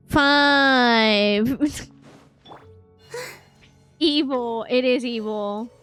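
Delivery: ordinary talk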